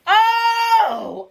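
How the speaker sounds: loud, high outraged cry; furious shout. Real, not acted. Venting at her son's father, shouting in outrage. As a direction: explode with real outrage. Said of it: "I am so mad right now".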